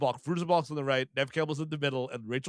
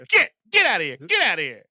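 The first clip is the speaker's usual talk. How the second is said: shooing yell; 6 dB louder than usual. Retold or imitated. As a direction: chase him off like a raccoon. Shooing the snake guy away.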